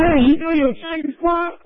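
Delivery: imitating the officer. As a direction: lightly play the cop who pulled him over.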